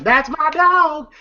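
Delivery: high, slow, drawn-out boast; Smokey as Paul Heyman. Retold or imitated. Smokey celebrating his friend's win, imitated triumphant crowing. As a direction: crow it like a triumphant fight manager. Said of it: "He's acting just like Paul Heyman".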